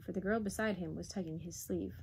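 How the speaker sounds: plain reading voice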